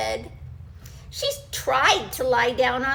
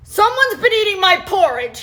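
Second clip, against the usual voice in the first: loud, high, growling daddy bear; growled; 6 semitones higher, 11 dB louder than usual. Acted. Daddy Bear growling at his empty bowl. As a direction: growl it like a big angry bear. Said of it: "he growled".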